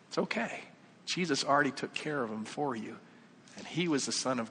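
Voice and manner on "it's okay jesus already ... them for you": soft, lifted, soothing reply; gentle reassurance. Acted. Answer to the protesting sinner, gently reassuring. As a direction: hush the worry away.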